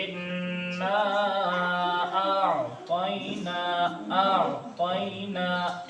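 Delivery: आतैना drawn out very slowly, long vowel held; stretched madd. Shown as a demonstration. Teacher shows the student how long to stretch the madd. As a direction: hold the long आ in आतैना for several counts. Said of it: "खींच कर पढ़ेंगे".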